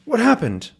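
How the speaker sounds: high, quick alarmed question; startled question. Acted. Crew hears a gunshot, asks in high surprise. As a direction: blurt it out in surprise.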